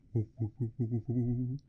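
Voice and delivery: repeated breathy whoo sounds imitating passing monsters; imitated whooshing. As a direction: mimic the whoosh of the music, over and over. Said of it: "every time one of those things passes".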